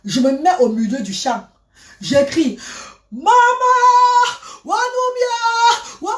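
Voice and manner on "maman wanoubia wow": long, high drawn-out wail; wailing for mother. Retold or imitated. Grieving daughter alone in a field calls her mother, slow high wail. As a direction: cry out to her dead mother, stretched and high.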